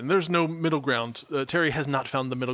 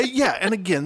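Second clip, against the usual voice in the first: usual speaking voice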